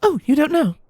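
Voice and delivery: very high mayor voice, absently realizing; absent realization. Acted. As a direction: let it dawn on you, pitched very high. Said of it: "the mayor said absently, as though something just dawned".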